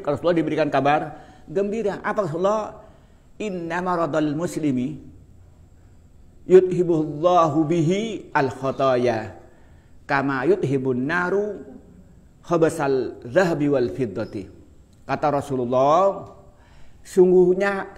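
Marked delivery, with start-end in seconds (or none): 3.4-14.5 slow, measured chanting of the Arabic text; Arabic hadith recitation. Acted. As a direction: recite the prophet's words with reverence.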